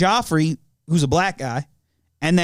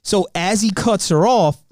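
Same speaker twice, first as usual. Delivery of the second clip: ordinary talk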